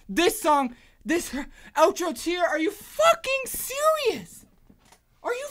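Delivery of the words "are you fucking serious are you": high, drawn-out disbelief; incredulous squeal. Real, not acted. Fan stunned by the outro, incredulous squeal. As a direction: stretch it out high, unable to believe it.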